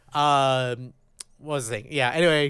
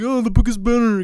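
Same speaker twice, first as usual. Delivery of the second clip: higher mocking book-snob voice; mock know-it-all asshole; 4 semitones higher than usual. Podcaster mocking his own book snobbery, know-it-all asshole voice. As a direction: put on an insufferable book purist. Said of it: "I'm always that asshole".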